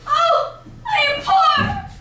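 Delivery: high, slow, shouted wail; loud anguished wail. Acted. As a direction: wail it out like a beggar. Girl wails her poverty.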